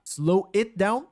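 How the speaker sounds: slow, drawn-out teaching read; slow demo. Shown as a demonstration. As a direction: stretch it out again for learners. (bem devagar, didático)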